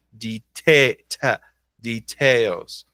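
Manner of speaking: "deta-" broken off on a strong TA; slow "details", weight on the second syllable; stressed syllable shown. Shown as a demonstration. Teacher isolating the strongest syllable, "details" stressed on TAILS. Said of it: "a sílaba mais forte".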